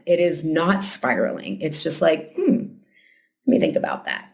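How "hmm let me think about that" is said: acted neutral, curious musing; calm curiosity. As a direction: ponder it without judgment. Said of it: "Self-awareness is curious. It's calmer".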